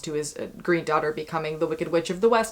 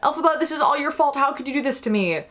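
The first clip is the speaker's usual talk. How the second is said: mock accusing outburst; 7 semitones higher than usual. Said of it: "Nessa is like".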